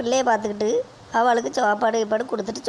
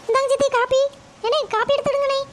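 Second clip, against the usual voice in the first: put-on much higher, brighter young woman's voice; daughter-in-law voice; 13 semitones higher than usual. Daughter-in-law answering her mother-in-law, in a much higher young voice. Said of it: "மருமகளே, போய் coffee கொண்டு வாமா".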